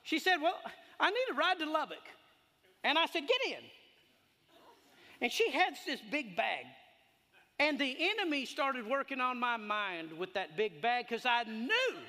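loud, high preaching at full force; fired-up preaching. Acted. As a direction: preach it like a revival.

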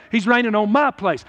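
ordinary talk, nothing marked

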